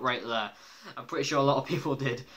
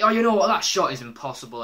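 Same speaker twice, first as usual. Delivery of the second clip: louder, exasperated retold complaint; mild mock raging; 3 semitones higher, 8 dB louder than usual. Imitating Homer missing the golf shot, mildly raging. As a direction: lightly imitate a frustrated golfer. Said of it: "he's raging and raging".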